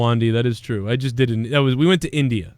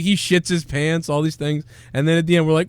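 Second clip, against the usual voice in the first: usual speaking voice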